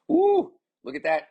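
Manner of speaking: high, loud whoop of surprise; excited sportscaster's ooh; impressed exclamation. Real, not acted. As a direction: burst out, amazed by the play. Reacting to a leap in play.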